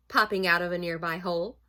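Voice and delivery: plain reading voice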